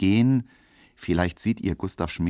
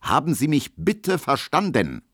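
higher-pitched, insistent check; cool authority; 8 semitones higher than usual. A narrator's reading. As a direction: demand obedience as master of the hall. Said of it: "sagt er mit kühler Autorität". (bestimmt und würdig)

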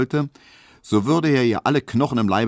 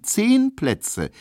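ordinary delivery

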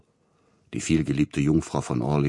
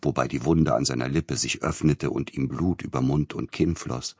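ordinary delivery, nothing marked

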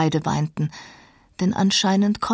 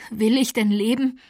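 lightly voiced dying woman, pleading and higher; a dying young woman begging her lover, voice raised in pitch; higher-pitched anguished Catherine; Catherine, despairing; 5 semitones higher than usual. A narrator's reading.

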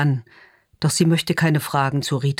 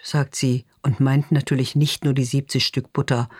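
usual speaking voice

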